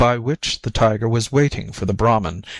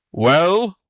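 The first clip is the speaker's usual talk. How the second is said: high, rising impatient demand; exasperated cry; 7 semitones higher, 3 dB louder than usual. A narrator's reading. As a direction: tiger pressing sharply for an answer. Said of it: "cried the tiger impatiently".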